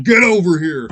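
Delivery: Scorpion's catchphrase. Retold or imitated. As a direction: bellow it like Scorpion pulling in his foe. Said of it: "Scorpion's Ninja Clan".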